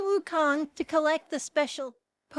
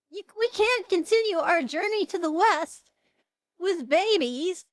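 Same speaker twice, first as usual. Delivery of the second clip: exasperated objection; 6 semitones higher, 5 dB louder than usual. Retold or imitated. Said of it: "Sun Wukong is like".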